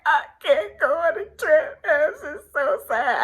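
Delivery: high, whiny sob-talk; a hammed-up tearful plea to a friend; mock crying. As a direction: wail it like a kid in tears. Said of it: "I was crying for sadness".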